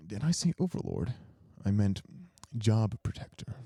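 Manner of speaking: ordinary delivery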